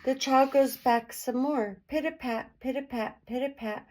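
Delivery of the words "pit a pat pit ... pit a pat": light, soft little footsteps; soft tiptoe. A narrator's reading. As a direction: patter it softly, on tippy toe. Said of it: "softly on tippy toe".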